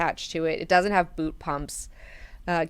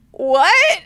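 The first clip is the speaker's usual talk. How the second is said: high, loud burst of disbelief; incredulous what; 12 semitones higher, 11 dB louder than usual. Retold or imitated. Duncan stunned that Garen's knife is only decoration. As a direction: blurt it out, stunned.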